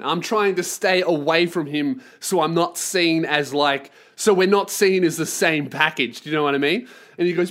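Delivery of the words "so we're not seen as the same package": ordinary talk, nothing marked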